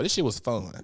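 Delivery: ordinary talk, nothing marked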